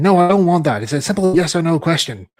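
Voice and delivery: loud, mocking retold scoff; mocking boss. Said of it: "he laughed at me saying".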